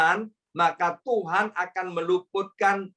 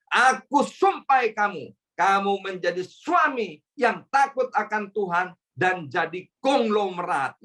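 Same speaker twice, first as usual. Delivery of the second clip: wife demonstrating a good curse, raised and solemn; wife's blessing curse; 5 semitones higher than usual. Shown as a demonstration. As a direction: pronounce it like an earnest wife blessing her husband. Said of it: "Tapi kutuknya begini".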